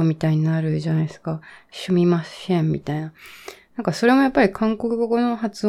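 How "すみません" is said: すみません said as "shumimashen". Retold or imitated. Speaker imitating Korean learners of Japanese, sh for s. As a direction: turn each s in すみません into sh. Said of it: "さしすせその発音が苦手で".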